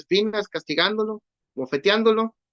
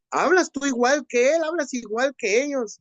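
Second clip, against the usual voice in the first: higher, pressing accusation; insistent accusation; 7 semitones higher than usual. Retold or imitated. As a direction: push the charge harder, voice up. Accuser repeats, more insistent.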